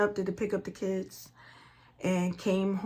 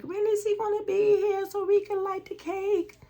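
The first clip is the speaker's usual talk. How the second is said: put-on kids' voice, much higher; impatient kids; 13 semitones higher, 3 dB louder than usual. Mother imitating her kids begging to light the cake, high childish whine. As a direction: mimic the kids whining about dad being late.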